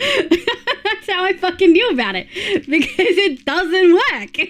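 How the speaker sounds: high, drawn-out words through laughter; laughing. Real, not acted. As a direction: laugh your way slowly through it. Laughing at the failed AI orders.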